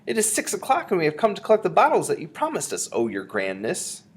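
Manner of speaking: put-on high-pitched, deferential old witch; humble, squeaky underling; meek voice. A narrator's reading. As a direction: grovel meekly in a high voice. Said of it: "said a meek voice from behind the door".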